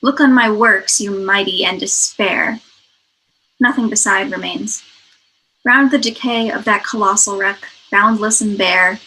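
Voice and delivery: loud, measured poetry reading; poem recitation. Acted. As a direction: declaim the verse, building to full volume. Reads the poem aloud.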